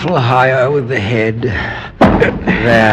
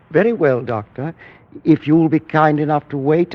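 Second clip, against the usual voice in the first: usual speaking voice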